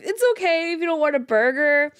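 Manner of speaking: gentle words through real laughter; a laugh caught in the voice. Real, not acted. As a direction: try to reassure but keep cracking up. Still laughing at the memory.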